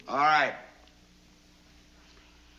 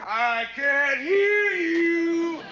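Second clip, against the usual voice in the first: slow, drawn-out high shout; drill sergeant shout; 7 semitones higher, much slower than usual. Acted. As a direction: bellow at the platoon slowly. Drill sergeant demands louder answer.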